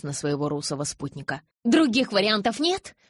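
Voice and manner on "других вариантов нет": high, sudden outburst; blurted out. A narrator's reading. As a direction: blurt it out in alarm. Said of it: "вдруг выпалила".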